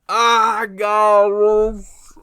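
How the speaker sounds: a huge high wail; real heartbroken outburst; anguished outcry. Real, not acted. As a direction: cry out, overwhelmed by the ending. Overcome by the final scene.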